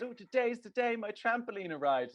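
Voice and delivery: a hint of an excited kid; excited little girl. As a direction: squeal a little with childish excitement. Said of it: "some little excited girl".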